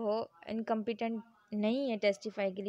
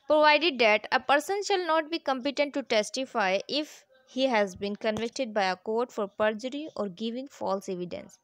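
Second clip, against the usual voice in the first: slow, deliberate, louder reading of the law text; statute reading; 4 dB louder, much slower than usual. Acted. Teacher reading the law to students, slow formal reading. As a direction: read each clause out slowly, like dictating.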